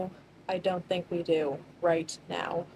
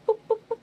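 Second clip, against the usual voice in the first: playful sneaking boops; 4 dB louder, faster than usual. Shown as a demonstration. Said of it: "sneaking sound effect".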